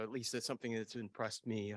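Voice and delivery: ordinary talk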